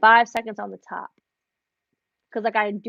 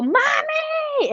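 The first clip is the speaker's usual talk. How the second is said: put-on much higher, bright child's call of "Mommy!"; excited little girl. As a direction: call out like a little girl running to her mom. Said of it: "she'll see me and go".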